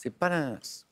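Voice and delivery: ordinary talk, nothing marked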